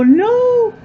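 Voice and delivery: high shouted mock no; a high, loud playful no; imitating Lex's no. Friend Lex objecting to Danny Dyer, shouted high no. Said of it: "I can hear Lex right at this moment go".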